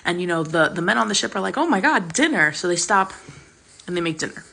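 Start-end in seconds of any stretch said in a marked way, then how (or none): 1.6-2.5 excited. Retold or imitated. Hungry men spotting goats.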